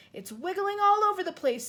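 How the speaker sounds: watching it thrash; alarmed cry. Acted. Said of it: "Sophie cried".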